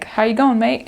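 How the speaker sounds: breezy Australian "How you going, mate?" with a lift on going; tossing off an Aussie greeting; Australian accent. Retold or imitated. Australian accent imitating a local greeting a missionary.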